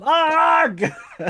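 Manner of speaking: high, loud burst of laughter; squealing laugh. Real, not acted. Overcome by the love confession scene, squealing laugh. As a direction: let a shriek of laughter out.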